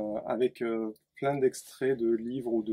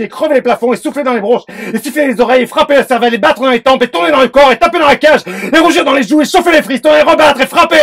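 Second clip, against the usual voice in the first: loud, much higher, breathless pounding recitation; frantic poem reading; 9 semitones higher, 18 dB louder than usual. Acted. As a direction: hammer the lines out like a racing pulse. Author reads his poem frantically.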